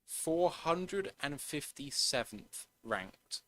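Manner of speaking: slow, measured delivery of a ranking; deliberately slow. Real, not acted. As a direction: spell the figure out slowly, word by word. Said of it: "I'll say this slowly".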